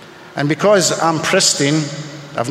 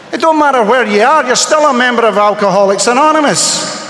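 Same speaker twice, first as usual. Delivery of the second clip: raised, emphatic call to the whole room; passionate declaration; 6 semitones higher, 4 dB louder than usual. Real, not acted. Speaker to the meeting, rousing loud declaration. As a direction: drive it home loud and proud.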